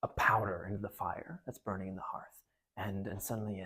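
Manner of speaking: soft whispered storytelling; hushed narration. A narrator's reading. Narrator building the spell scene in a whisper.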